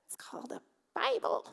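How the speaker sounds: high breathless whisper, like a secret; playful whisper. Acted. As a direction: let it out as a cheeky stage whisper. Joking aside about the Bible.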